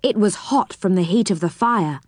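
plain reading voice

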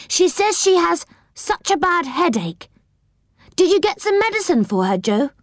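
put-on high young girl voice; Franny. A narrator's reading. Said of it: "she said".

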